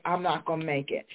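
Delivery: ordinary delivery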